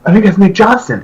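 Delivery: high, slow, awestruck; amazed gasp. Retold or imitated. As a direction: realize your idol is on stage.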